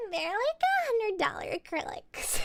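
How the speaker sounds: high, hammed-up Valley girl whine; exaggerated Valley girl accent. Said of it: "I'll just have to talk like this".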